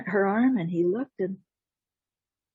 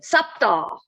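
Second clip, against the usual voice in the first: raised, punchy cat call; cat's cry; 4 semitones higher, 4 dB louder than usual. Acted. Witch cat taunts Jack once more, raised cry. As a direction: call it out higher in a put-on voice.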